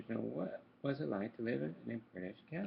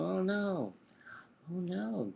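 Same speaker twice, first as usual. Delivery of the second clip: gasping fright; 5 semitones higher, 3 dB louder, much slower than usual. A narrator's reading. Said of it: "gasped Donald".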